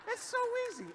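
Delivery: high falsetto. Shown as a demonstration. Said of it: "repeated high".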